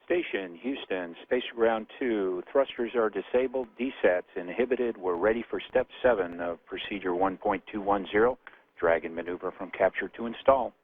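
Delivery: distant crackly radio voice; radio transmission. Real, not acted. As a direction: speak like over a space radio link. Ground radio call to station.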